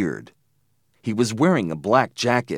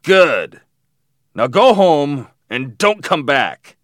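loud, slow, higher command; shouted order; 5 semitones higher, 7 dB louder, slower than usual. A narrator's reading. Man sending the boys home, shouted order. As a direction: shout it as a menacing dismissal. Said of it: "the man shouted".

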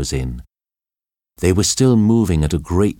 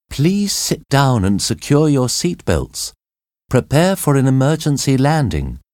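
raised over screams; 7 semitones higher than usual. A narrator's reading. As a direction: call it out over the panic, keeping control. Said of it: "Cathy said above the screams of the passengers".

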